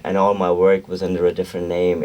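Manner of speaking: normal talking voice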